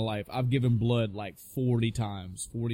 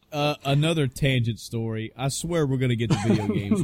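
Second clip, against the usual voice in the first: ordinary delivery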